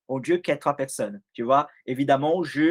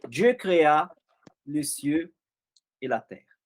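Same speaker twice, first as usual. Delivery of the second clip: scripture reading. Acted. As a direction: read Genesis aloud with measured pauses. Lecture solennelle de la Genèse. (lecture biblique solennelle)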